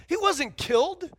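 ordinary talk, nothing marked

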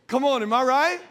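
ordinary talk, nothing marked

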